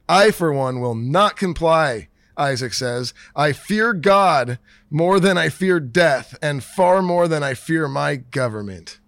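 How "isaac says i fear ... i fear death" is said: normal talking voice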